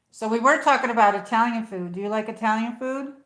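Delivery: loud livestream chatter; yelling a bit. Real, not acted. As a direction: call out to the viewers over the distance. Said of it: "I feel like I'm yelling".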